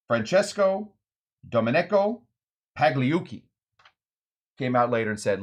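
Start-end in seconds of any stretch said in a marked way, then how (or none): none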